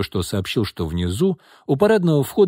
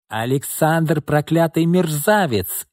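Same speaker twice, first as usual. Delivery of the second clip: slow, higher hysterical yell; hysterical shouting; 5 semitones higher, slower than usual. Acted. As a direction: scream it out, betrayed and frantic. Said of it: "истерично кричала Ирма".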